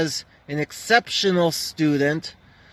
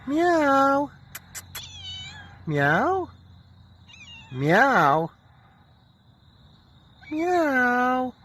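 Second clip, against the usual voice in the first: mock meow; 9 semitones higher, much slower than usual. Meowing back and forth with cat.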